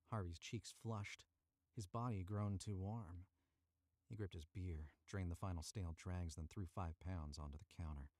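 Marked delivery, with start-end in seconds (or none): none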